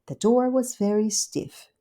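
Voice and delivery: usual speaking voice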